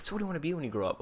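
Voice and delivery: buttering him up in a sweet cop voice; good cop voice. Retold or imitated. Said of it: "first he's all rough, now he's like".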